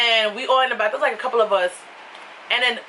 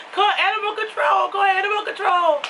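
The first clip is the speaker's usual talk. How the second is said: high, repeated urgent cry; mock frantic plea; 9 semitones higher than usual. Into the news microphone, repeating a hammed-up plea. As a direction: beg over and over for animal control.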